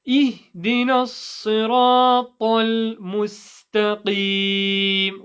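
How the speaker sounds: slow, measured chant; drawn-out, careful recitation; Quranic recitation. Shown as a demonstration. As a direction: recite with long held vowels for students to follow.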